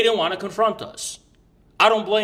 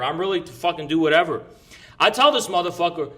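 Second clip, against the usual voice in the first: usual speaking voice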